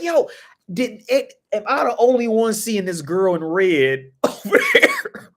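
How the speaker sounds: high, loud, laughing disbelief. Retold or imitated. Gamer spotting the girl in red, loud laughing disbelief. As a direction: call out like nobody else sees her.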